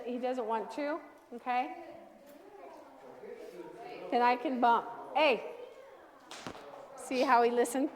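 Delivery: normal talking voice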